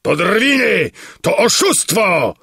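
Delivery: high, outraged cry; indignant shout. A narrator's reading. Spectator protesting to the king about fake lions, indignant shout. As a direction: shout it in protest, pitched well up. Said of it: "krzyczał, wymachując pięścią".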